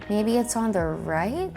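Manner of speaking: usual speaking voice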